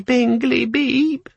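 eager tiny machine, voice climbing; high chirpy gadget beep; hopeful little gadget. A narrator's reading. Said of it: "a voice ... said hopefully".

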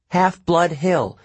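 name the place slowly and clearly; slow request. Acted. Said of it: "Annabeth requested".

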